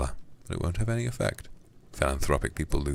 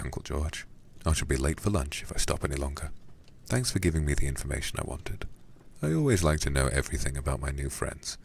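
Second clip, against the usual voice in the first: plain reading voice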